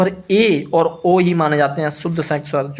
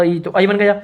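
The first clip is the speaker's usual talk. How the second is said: usual speaking voice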